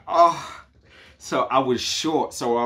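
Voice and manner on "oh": dismayed oh. Retold or imitated. Embassy took a quarter.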